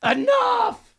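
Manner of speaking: high, slow, shouting exasperation; exasperated high voice. Pretend. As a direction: shriek at them to stop talking. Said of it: "this is me".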